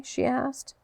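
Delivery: ordinary delivery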